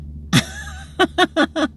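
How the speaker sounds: high, airy burst of laughter; helpless real laugh. Real, not acted. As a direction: crack up at your own joke. Laughs at own joke.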